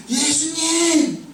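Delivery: acted distant shout, pitched well up; pleading shout. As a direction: cry out for mercy across a distance. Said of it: "이렇게 소리 질렀어요".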